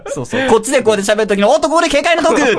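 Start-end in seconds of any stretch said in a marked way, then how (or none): 1.5-2.6 sports commentator. Pretend. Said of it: "とか".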